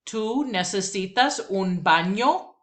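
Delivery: "baño" rising at the end as a question; Spanish question rise. Shown as a demonstration. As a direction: lift "baño" up at the finish. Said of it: "One more time".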